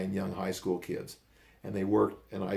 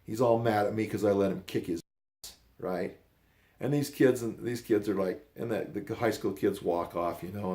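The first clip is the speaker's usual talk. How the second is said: normal talking voice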